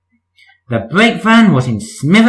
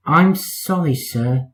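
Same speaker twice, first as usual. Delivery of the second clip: softer, contrite sorry; soft shamed apology; sheepish apology; 5 dB quieter, slower than usual. Acted. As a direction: apologise meekly to the boss. Percy apologising to Fat Controller.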